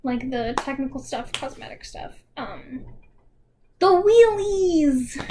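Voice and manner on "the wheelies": drawn-out, higher and louder delight; excited cry. Real, not acted. Essayist revealing the roller-shoe staging, drawn-out excited cry. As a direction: savor the word like a fun surprise.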